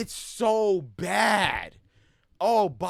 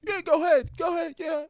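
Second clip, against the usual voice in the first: high, overly sweet waving-you-on voice; high mocking nice voice; mock polite driver; 9 semitones higher, faster than usual. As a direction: mimic a too-polite driver. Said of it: "they're like".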